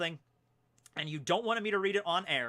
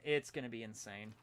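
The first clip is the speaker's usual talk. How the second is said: usual speaking voice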